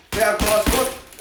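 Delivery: jab, cross, hook fired off fast and loud; quick combo call. Shown as a demonstration. Full-speed take before slowed demo.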